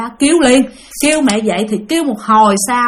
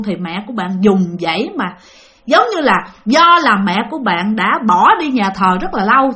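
ordinary delivery

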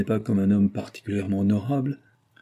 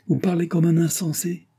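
impatient, much higher and sharp; impatient outburst; 9 semitones higher than usual. A narrator's reading. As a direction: snap it at him impatiently. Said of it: "avec impatience". (éclat impatient, voix bien plus aiguë)

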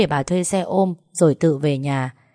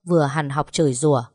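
ordinary talk, nothing marked

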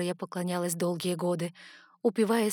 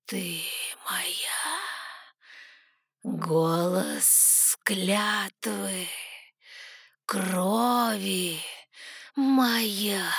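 hushed, slow, possessive divine voice; goddess; much slower than usual. A narrator's reading. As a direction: whisper the claim like poison. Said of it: "Тягучим сладким ядом ... божественный голос".

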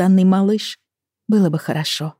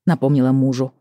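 ordinary delivery

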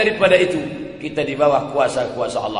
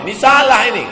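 loud protest; 7 semitones higher, 8 dB louder, faster than usual. Acted. Companion objects to the caliph.

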